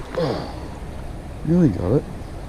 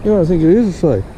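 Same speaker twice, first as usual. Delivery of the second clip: excited; 6 semitones higher than usual. Real, not acted. Finds a safe magnet fishing.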